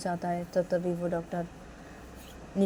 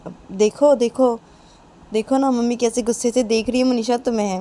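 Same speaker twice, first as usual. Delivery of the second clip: raised, loud, repeated urging; insistent pointing-out; 5 semitones higher, 9 dB louder than usual. Acted. Nisha shows Manisha mother's glare, loudly insistent. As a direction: make a noisy point of it.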